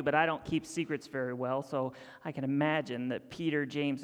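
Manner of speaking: usual speaking voice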